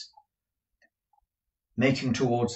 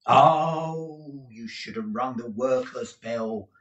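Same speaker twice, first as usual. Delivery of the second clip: condescending footman; 3 semitones higher, 4 dB louder, slower than usual. Acted.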